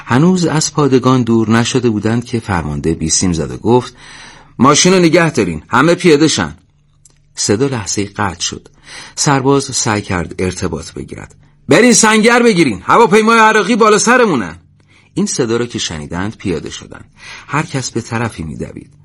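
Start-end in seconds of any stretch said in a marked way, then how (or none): 4.6-6.6 louder, higher-pitched order; urgent command. A narrator's reading. Commander orders over radio. 11.7-14.6 loud, very high-pitched alarm; urgent shouted warning. A narrator's reading. Warning of an enemy plane.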